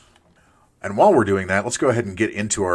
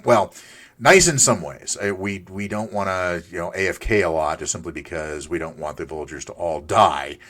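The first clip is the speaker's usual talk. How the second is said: ordinary talk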